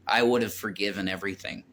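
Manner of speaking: normal talking voice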